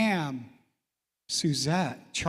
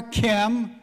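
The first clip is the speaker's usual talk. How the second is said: usual speaking voice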